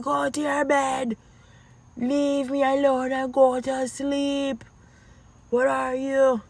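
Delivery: high, strained mock scolding; high-pitched put-on nagging; exasperated mom. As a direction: order the kids to bed, fed up, acted out. Acts out mother ordering kids to bed.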